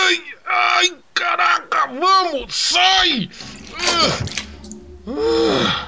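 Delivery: high strained crying out; straining cry. Acted. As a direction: strain and whimper while pushing.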